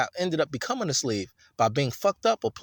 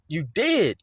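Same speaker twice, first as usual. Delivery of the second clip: ordinary talk, nothing marked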